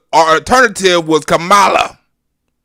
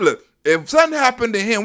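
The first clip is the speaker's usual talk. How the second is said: ordinary delivery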